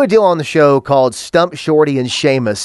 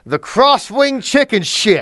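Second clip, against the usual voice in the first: put-on slow, higher, bored drunk wrestling announcer; 7 semitones higher, slower than usual. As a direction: drawl The crosswing chicken shit like a sloppy ringside announcer. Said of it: "You sound like a drunk wrestling announcer who doesn't care".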